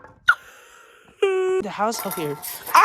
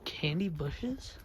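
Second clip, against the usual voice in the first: slow hushed whisper; 4 semitones lower, 10 dB quieter, much slower than usual. Pretend. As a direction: whisper in wonder. Sneaking pretend search.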